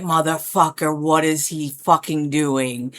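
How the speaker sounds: low, slow, softened astonishment; hushed fangirl awe. Retold or imitated. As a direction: murmur it slowly, awestruck at your idol. Acting out an awed fangirl watching.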